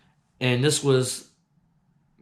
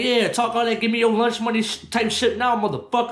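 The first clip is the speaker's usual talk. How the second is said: raised, cocky taunt; taunting challenge; 8 semitones higher than usual. Retold or imitated. Waiting on the corner, taunting the bully who wanted lunch money. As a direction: goad him, daring him to repeat it.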